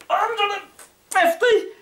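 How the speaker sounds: high strained cry; straining effort. Real, not acted. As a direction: push out the final count. Last sit-up done.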